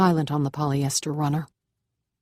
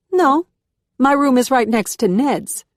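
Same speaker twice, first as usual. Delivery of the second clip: higher, louder South American lilt with a Southern drawl; South American Southern accent; 9 semitones higher, 7 dB louder than usual. A narrator's reading. Curious housemate offers the new girl help, South American Southern accent, higher and louder. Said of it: "a South American cadence slowed with a Southern drawl".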